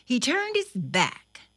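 ordinary delivery

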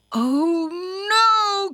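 high, loud cry of dismay; alarmed cry. A narrator's reading. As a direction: cry out as danger appears. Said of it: "cried Thumper".

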